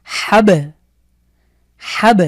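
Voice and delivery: হাব with ব jolted loud and high; হাব with a strong bounce; bounced ব. Shown as a demonstration. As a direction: punch the ব of হাব. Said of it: "ধাক্কা দিয়ে পড়লে হবে".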